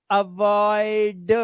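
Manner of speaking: अवॉइड stretched on 'oy', released 'duh'; slow sounding-out. Shown as a demonstration. Teacher showing how to learn gradually, slow sounding-out. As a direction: say अवॉइड very slowly, 'a-voy-duh'. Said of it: "इस तरह से आप धीरे धीरे".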